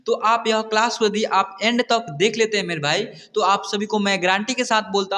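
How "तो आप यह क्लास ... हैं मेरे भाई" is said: ordinary talk, nothing marked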